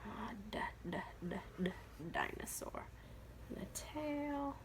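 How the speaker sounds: soft voiceless whisper; whispered sounding out. Real, not acted. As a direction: hush while drawing. Whispering while drawing dinosaur.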